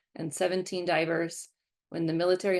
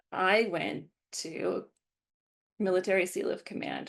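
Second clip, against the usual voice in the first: ordinary talk, nothing marked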